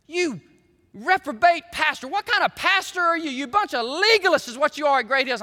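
imitated angry churchgoer. Angry people in the pastor's face, imitated indignant tirade. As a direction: act out someone berating the pastor. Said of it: "get in my face, mad at me".

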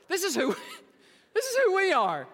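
high, loud, laughing delivery; laughing through words. Real, not acted. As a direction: crack up while announcing it. Cracking up at the plaque message.

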